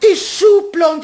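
shocked cry. Acted. Frau Eichinger seeing the drawer fall, shocked cry. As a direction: cry out at the crash.

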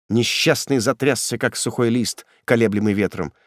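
plain reading voice

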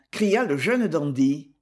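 plain reading voice

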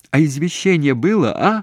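high, loud urgent question; shouting. A narrator's reading. Daughter asks mother about the death notice, shouting. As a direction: shout it down the phone. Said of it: "всё ещё кричала".

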